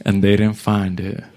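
usual speaking voice

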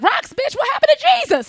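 very high, loud scolding mom. Retold or imitated. Mom scolding daughter over rocks, shrill outrage. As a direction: shriek it like an outraged mother. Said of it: "my mom is like".

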